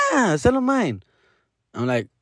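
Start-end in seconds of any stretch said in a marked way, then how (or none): 0.1-1.0 put-on high-pitched kid answer; young son. Son reassuring dad about selling his bobblehead, child's voice. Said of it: "He's like".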